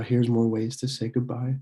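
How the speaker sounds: usual speaking voice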